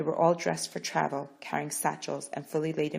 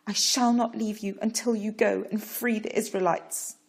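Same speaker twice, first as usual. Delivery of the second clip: higher, firm vow; determined; 6 semitones higher, 6 dB louder than usual. Acted. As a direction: refuse to let go. Parrot vowing at Pharaoh's feet.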